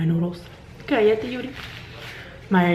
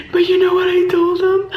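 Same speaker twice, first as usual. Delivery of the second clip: much higher and louder, crying like a movie scene; mock sobbing cry; 12 semitones higher, 5 dB louder than usual. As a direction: wail it out in hammed-up grief. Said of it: "she even changed her voice".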